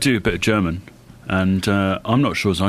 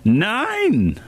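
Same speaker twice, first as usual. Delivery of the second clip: mock German nein; 14 semitones higher than usual. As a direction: bark it like a cartoon German. Punning nein on nine.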